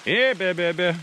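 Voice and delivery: loud high call to warn bears; scaring off a bear. Real, not acted. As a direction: call out loudly into the woods. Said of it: "So I'm singing the Hey Bear Bear song".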